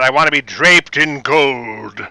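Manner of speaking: grand actor's voice, slow and drawn out; John Colicos. Retold or imitated. Actor telling costumer and makeup artist his look, grandly theatrical. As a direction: savor each word like a theatrical villain. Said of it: "in his best pre-Count Baltar voice".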